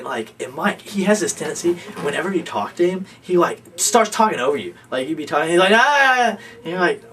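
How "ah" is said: a loud, high ah that cuts someone off; mock interruption of a roommate mid-sentence; loud interrupting ah. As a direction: blurt it to drown the other person out. Imitating Mike talking over people.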